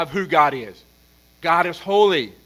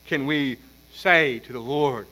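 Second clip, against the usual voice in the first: normal talking voice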